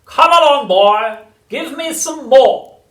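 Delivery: high, loud, slow screechy chant; sing-song triumph of a crazed old crone; shouting old grandma. A narrator's reading. Grandma rising through the house, shrieking singsong. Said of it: "she yelled".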